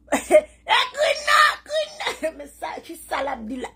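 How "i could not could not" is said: high, loud through laughter; can barely get the words out for laughing; laughing helplessly. Real, not acted. Cracking up with her audience over the rivals' silence, high and laughing.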